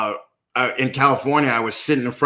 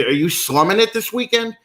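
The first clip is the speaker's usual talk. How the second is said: high, rising mock question; teasing incredulity; 9 semitones higher than usual. Asking smart people why they showed up, teasing incredulity. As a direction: pitch it up in playful disbelief.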